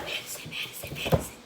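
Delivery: breathy whisper calling a name. Retold or imitated. Grandma whispering to child in bed. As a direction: whisper the name to spook her. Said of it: "whispering, like".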